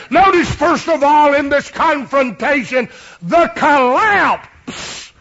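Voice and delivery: loud, high preacher's shout; full-force preaching. Acted. Preacher to congregation, loud high preaching shout. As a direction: preach it out to the whole church.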